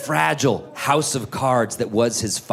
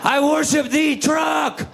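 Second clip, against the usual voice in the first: worshipful shout; 14 semitones higher, slower than usual. Retold or imitated. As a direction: cry out to the truck like it's a deity. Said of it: "as worshipful".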